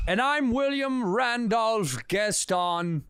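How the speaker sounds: pompous slow voice. Acted. Pompous fake name introduction.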